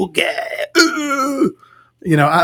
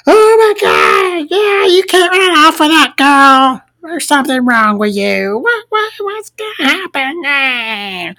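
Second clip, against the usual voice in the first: mock melodramatic mother; 11 semitones higher than usual. Mocking the overbearing mother scolding her son, high and hammy. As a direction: parody an overwrought mother way up high.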